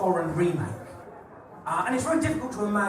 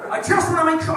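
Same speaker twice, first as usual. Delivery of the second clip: high excited delivery; 9 semitones higher, 4 dB louder, faster than usual. Acted. Continues after salute.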